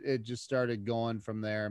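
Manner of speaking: ordinary talk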